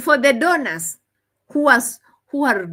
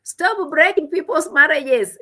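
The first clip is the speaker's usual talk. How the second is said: mock quote; 7 semitones higher, 5 dB louder than usual. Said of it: "he said".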